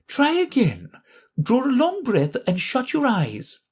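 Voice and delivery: high instructing Queen voice; Queen coaching Alice; coaxing Queen. A narrator's reading.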